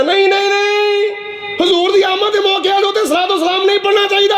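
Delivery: very high, mocking protest voice; mock the naysayers in a high-pitched whine; mimicked objector. Preacher imitating those who object to salutations, high mocking protest.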